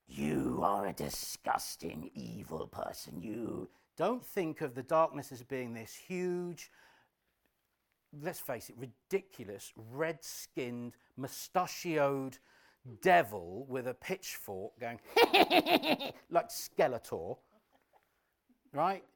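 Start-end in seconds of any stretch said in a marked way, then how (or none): 0.1-3.7 very low, slow villain growl; gruff devil voice. Acted. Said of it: "don't ever think that the darkness will be". 15.0-16.2 high hammed-up villain laugh; loud cartoon-devil cackle; evil cackle.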